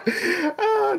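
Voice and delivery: squeaky burst of laughter; high laugh. Real, not acted. Cracks up at own joke.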